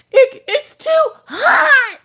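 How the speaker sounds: put-on high, slow, crying kid's complaint; whiny toddler wail. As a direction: wail it like a frustrated little girl. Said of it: "She's like".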